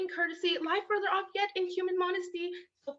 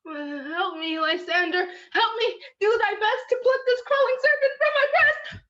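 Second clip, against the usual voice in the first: rising frightened cry, louder at the end; terrified cry for help; 4 semitones higher, 9 dB louder than usual. Acted. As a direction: call out in panic from a bad dream. Hermia wakes from a nightmare.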